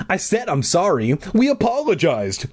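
high-pitched whiny apology; whining. A narrator's reading. Bully apologising to the boy who beat him, whining. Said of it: "Barry whined".

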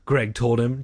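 plain reading voice

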